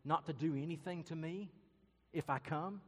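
put-on nervous girl pleading, pitched a little high; mildly anxious girlish plea; frightened girl. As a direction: ask warily, rising as you go. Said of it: "she looks at the lion and says".

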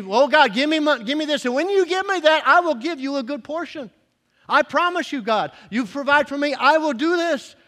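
high, loud pleading with God; mock bargaining prayer. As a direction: plead and promise, hammed-up.

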